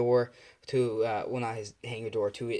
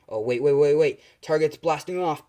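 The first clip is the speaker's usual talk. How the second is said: louder, quick repeated waits; urgent pilot calling it in; urgent alert; 3 semitones higher, 7 dB louder than usual. Acted. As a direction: raise the alarm as the target takes off. Pilot spots the target fleeing.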